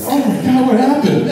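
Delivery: high, loud panicked shriek; shrieking burn victim. Retold or imitated. Comic retells a woman panicking in the mirror. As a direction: squeal it like a horrified woman. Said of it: "They're like".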